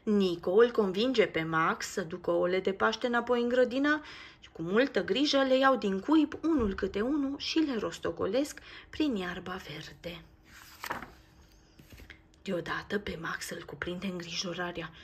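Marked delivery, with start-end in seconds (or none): none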